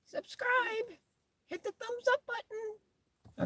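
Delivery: very high, soft squeaky little pet voice; tiny high-pitched voice, drawn out at the end; dog's pretend voice. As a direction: speak for the dog in a tiny falsetto.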